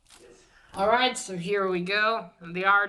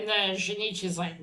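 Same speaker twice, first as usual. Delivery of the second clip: ordinary talk, nothing marked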